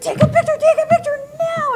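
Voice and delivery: high, loud, demanding kid impression; imitated child yelling. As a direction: yell an urgent order like an overexcited boy. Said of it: "And he's yelling at her".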